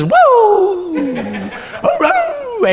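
very high laughing imitation of a dog's "Woo! Hooroo!"; dog howl. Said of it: "the second dog joins in with the first dog and just says".

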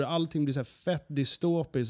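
ordinary talk, nothing marked

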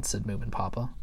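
usual speaking voice

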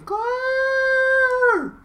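high, drawn-out shouted name; shouting. Retold or imitated. Co-host acting Jack calling for missing Claire, long shout. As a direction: bellow it out like calling someone lost. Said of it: "Jack starts shouting".

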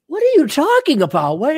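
don't get it at all; retold bafflement. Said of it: "I'm like".

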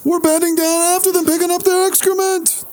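mock Jerry Seinfeld observational rant; Seinfeld impression. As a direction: do the Seinfeld standup cadence. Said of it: "isn't that a Seinfeld thing? He's like".

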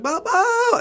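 very high held mock scream; retold screaming. Two men screaming at Bo, mock high scream. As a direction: squeal the name out as if screaming. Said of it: "him and I just started screaming at Bo".

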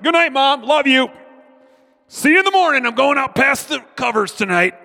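put-on high, loud little-boy voice; a boy boasting to his mom at bedtime, high and loud; kid's bravado. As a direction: chirp it up high like a cocky kid at bedtime. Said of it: "I'm like".

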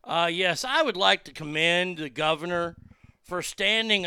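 put-on caller voice. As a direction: play a fired-up fake caller praising the governor. Prank message left for governor.

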